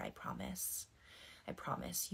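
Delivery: soft whisper. Real, not acted. Reassuring a hurting viewer.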